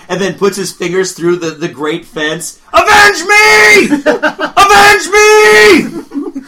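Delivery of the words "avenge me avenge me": high, slow melodramatic cry; dramatic shout. Pretend. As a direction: ham up a dying hero's last cry. Dramatic dying chess player.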